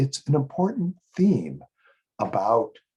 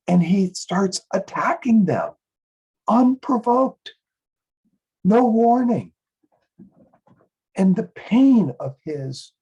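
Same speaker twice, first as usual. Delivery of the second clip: higher, louder, outraged telling; indignant storytelling; 7 semitones higher, 4 dB louder than usual. Acted. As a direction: recount it with raised, aggrieved outrage. Indignant account of an attack.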